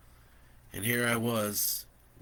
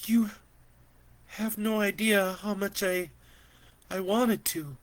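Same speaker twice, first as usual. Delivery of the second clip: higher, stumbling hurt voice; hurt auntie; 10 semitones higher, 3 dB louder than usual. Acted. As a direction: falter as if stung by the words.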